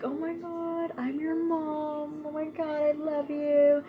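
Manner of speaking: high, gushing movie-mom voice; mock the over-the-top movie mom; gushing new mom. Vlogger mocking movie moms gushing over newborns, high and gushing. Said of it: "they're like".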